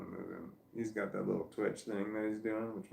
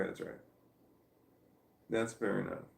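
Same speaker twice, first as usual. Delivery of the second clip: ordinary talk, nothing marked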